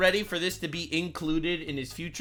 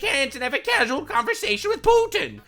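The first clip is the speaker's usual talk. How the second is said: much higher, mock outrage; mocking outraged Democrat; 8 semitones higher, 4 dB louder than usual. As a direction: gasp it like a clutching critic. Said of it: "they're losing their mind".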